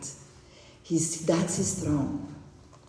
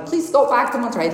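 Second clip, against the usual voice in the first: quick, raised plea, pitched up; retold shout; 7 semitones higher, 5 dB louder, faster than usual. Passenger begs pilot to turn back, shouting. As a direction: call it out loud and urgent, half-acted. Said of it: "I started shouting".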